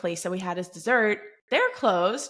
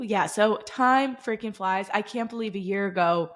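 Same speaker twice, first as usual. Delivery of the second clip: ordinary delivery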